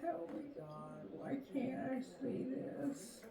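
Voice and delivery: barely audible muttered prayer under her breath; muttered plea. Real, not acted. Reader squinting at the card, begging God under her breath. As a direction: mutter it to yourself, almost inaudible.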